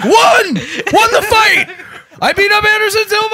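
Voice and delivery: high-pitched mock victory cry; triumphant yell. Puncher fleeing Anderson Silva, triumphant yell. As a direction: crow the win at the top of your voice.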